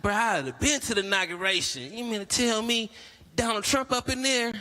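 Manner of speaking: high excited country cousin; amazed cousin. Retold or imitated. Sheltered cousin gawking at the Capitol, high and amazed. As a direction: gush with wide-eyed amazement.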